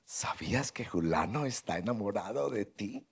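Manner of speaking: schoolgirl secret whisper. Retold or imitated. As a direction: confide it like a schoolgirl. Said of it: "como una colegiala que su compañerita en secreto le dice".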